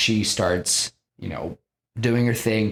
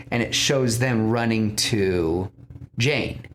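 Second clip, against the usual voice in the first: ordinary delivery, nothing marked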